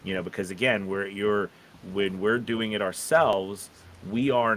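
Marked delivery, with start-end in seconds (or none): none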